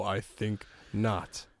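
very slow, low and soft; the family's mock Vader no; slow deep mock denial; Vader refusal. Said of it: "they just go".

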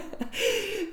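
high embarrassed giggle; embarrassed laugh. Real, not acted. Telling the story, a high embarrassed laugh. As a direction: laugh off the awkward goodbye. Laughing at own awkward exit.

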